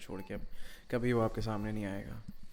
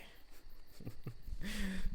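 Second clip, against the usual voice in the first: sadistic laugh; 9 semitones higher, 4 dB quieter than usual. Acted. Torturer laughs.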